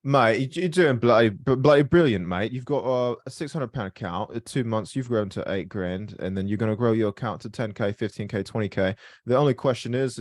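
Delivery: a cheesy Brit cheering his mate on in a put-on British accent; bad British accent. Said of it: "That was a pretty trash British accent".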